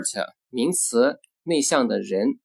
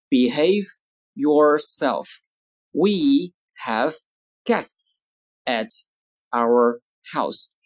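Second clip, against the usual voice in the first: slow, isolated words with clear pauses; word-by-word model; much slower than usual. Shown as a demonstration. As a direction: dictate slowly to language learners. Teacher models sentences word by word.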